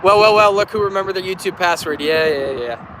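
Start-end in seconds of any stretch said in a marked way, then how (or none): none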